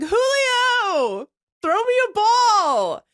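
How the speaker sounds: high, drawn-out call to a player; re-enacted fan's sing-out call. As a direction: holler for attention across a field. Calling to player on the field.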